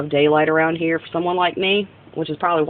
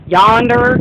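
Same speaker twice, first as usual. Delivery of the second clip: country yonder; 5 semitones higher, 7 dB louder than usual. Shown as a demonstration. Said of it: "they say stuff like".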